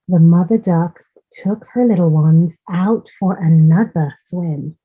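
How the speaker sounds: ordinary delivery, nothing marked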